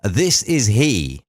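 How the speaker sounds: slow, prim posh reply; posh phone answer. Retold or imitated. DJ mocking how posh people answer the phone, slow posh voice. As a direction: answer the phone like a stuffy aristocrat. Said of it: "Posh people, uh, you know, when you, uh, you pick up the phone".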